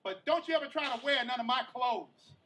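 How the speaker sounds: loud, teasing sass; trash-talking auntie. Retold or imitated. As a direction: tease him like a sassy auntie. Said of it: "that one auntie that's always talking trash".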